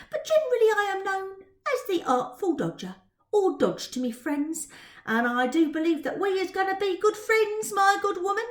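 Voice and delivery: swagger through it like a boastful pickpocket; Artful Dodger showing off; cocky street urchin. Acted. Artful Dodger introduces himself.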